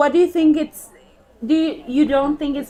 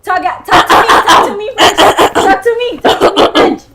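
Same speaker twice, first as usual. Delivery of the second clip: higher, louder playful demand; excited urging; 7 semitones higher, 7 dB louder than usual. Real, not acted. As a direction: egg her on, loud and eager. Urging friend to speak French.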